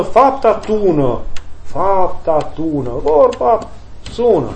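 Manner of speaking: the words "fapta tună" drawn out slowly, each syllable weighed; slow proverb. Real, not acted. Priest spelling out Arsenie's proverb to listeners, slow and weighty. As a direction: let the proverb land slowly and solemnly.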